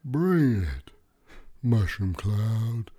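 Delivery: cocky, high taunting creature voice; tough water bear voice. Acted. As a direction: taunt the apocalypse like a tiny tough guy. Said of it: "why does he sound like that?".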